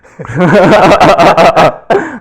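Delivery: a burst of high, loud laughter; loud rising laugh. Real, not acted. Laughs at his own quiet voice.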